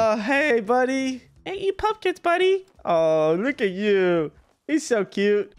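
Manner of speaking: high, cooing pet voice; talking sweetly to his new horse. Real, not acted. Baby-talks the horse.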